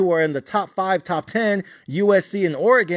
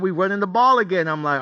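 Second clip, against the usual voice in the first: ordinary talk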